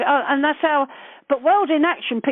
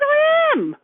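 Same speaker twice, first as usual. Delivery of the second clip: defiant retort; 3 dB louder than usual. Retold or imitated. Retold retort to a court insult.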